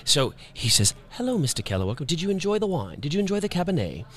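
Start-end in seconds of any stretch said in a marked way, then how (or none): 1.1-4.0 lightly British and higher, courteous; imitating a polite British bartender; British accent. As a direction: bartender Min asking about the wine, in a light British accent. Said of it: "he's British".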